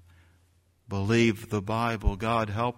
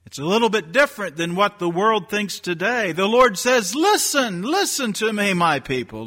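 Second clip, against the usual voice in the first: higher, fast and loud proclamation; fervent preaching; 9 semitones higher, 6 dB louder than usual. Acted. Preacher calling his flock to listen, high and loud. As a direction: build up and cry out the call to listen. Fervent build to the Lord's call.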